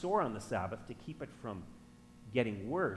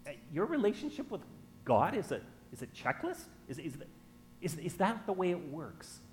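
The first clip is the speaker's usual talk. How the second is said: halting, higher mock disbelief; sceptical questioning; 5 semitones higher than usual. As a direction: act out a doubtful listener's question.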